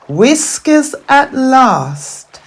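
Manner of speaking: ordinary delivery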